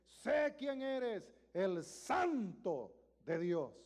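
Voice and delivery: very slow, loud, high demonic declaration; loud slow demonic proclamation; demon declaring. Acted. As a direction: stretch every word out like a defiant spirit. Demon voiced again.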